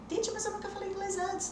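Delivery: high, put-on pupil voice; hesitant student objection. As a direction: imitate a beginner protesting to teacher. Voices a worried beginner student.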